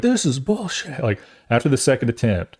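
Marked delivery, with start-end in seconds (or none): 0.0-1.0 slow, higher grumbling parent; a mock fed-up parent complaint; exasperated parent. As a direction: drag it out like an annoyed boomer parent. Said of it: "My parents would be like".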